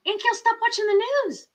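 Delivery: high, insistent telling-off; scolding niece. Retold or imitated. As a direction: firmly tell your aunt to stop. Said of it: "And I'm like".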